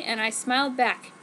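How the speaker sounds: usual speaking voice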